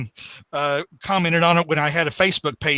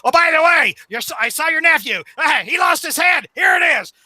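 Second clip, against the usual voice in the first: Norman's Goblin voice; 9 semitones higher than usual. Retold or imitated. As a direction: gloat like a cackling supervillain. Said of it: "Norman says".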